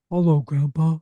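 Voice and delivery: a goofy funny-voiced greeting; playful silly voice. Shown as a demonstration. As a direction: play with the voice to make kids giggle. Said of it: "get playful".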